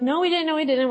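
playful retelling of a defensive denial; mock protesting. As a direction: protest innocence like an accused sibling. Acts sisters denying the accusation.

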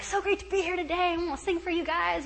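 a hint of an eager director greeting the prisoners; fired-up choir director. Choir director greets prisoners, mildly fired up. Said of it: "all fired up".

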